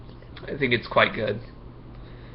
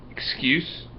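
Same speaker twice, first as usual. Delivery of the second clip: nervous question; 6 dB quieter than usual. A narrator's reading. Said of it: "Marco stuttered".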